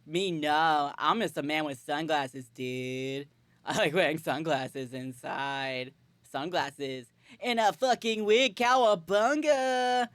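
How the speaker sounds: slow, drawn-out surfer dude drawl; surfer dude accent. Acted. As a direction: do a hammed-up surfer dude, stretching out the last word. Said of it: "like, a, like, a surfer dude accent".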